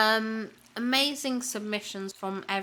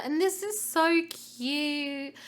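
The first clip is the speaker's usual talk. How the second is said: cooing; 6 semitones higher, slower than usual. Real, not acted. Gushing over a cute snap.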